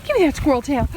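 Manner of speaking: usual speaking voice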